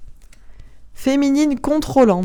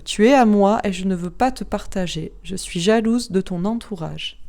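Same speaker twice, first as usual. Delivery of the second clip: ordinary talk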